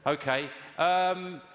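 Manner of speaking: ordinary talk, nothing marked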